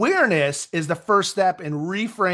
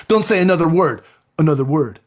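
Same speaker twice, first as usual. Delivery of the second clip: loud, firm retold scolding; playful mock threat, loud at first; mock exasperated warning; 8 dB louder than usual. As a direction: act out a fed-up parent's warning. Acted quarrel with daughters.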